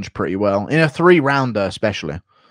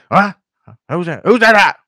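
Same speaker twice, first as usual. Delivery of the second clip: higher, louder startled confusion; confused Bisping; 5 semitones higher, 6 dB louder than usual. Retold or imitated. Streamer plays Bisping zoning out at dinner, startled. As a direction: jolt awake lost at the table. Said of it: "Bisping with Alzheimer's impression".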